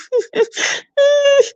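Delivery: high, squeaky laugh-cry; high-pitched mock sobbing giggle; imitated crying-laughing. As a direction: mimic laughing through tears. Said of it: "crying and laughing at the same time".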